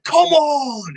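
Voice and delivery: eager rallying cry. Retold or imitated. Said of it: "Emmett's just like".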